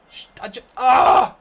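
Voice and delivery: strangled breathy cry breaking into a loud ah; frustrated yell. Real, not acted. Gamer lost for words after his error, frustrated cry. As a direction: choke on words then let out a yell.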